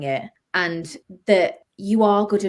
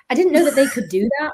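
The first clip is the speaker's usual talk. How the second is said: high, quick burst of disbelief; mock-baffled, rushed and high; astonished; 7 semitones higher, 4 dB louder, faster than usual. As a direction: retell your stunned reaction, pitched up. Retold astonished reaction to surprise email.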